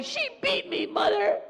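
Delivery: high whining tattle; little brother telling on sister; whiny little brother. Retold or imitated. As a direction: tattle like a wronged little kid. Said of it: "He'll say".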